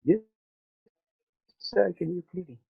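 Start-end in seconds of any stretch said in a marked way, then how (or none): none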